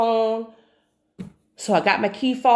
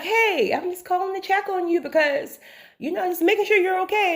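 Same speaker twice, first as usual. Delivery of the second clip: higher, friendly neighbor voice on the phone; voicing neighbor Buddy; 9 semitones higher than usual. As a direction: play the concerned neighbor checking in, pitched up. Neighbor Buddy calling to check.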